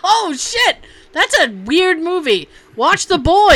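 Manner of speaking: loud, high, laughing excitement; excited outburst. Real, not acted. Host reacting to The Boy on the list, excited outburst. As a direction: burst in loudly with a delighted recommendation.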